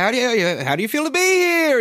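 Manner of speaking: very high, excited talk-show host; Chris Rock impression. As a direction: shriek it out like a manic host. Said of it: "when Chris Rock's just like".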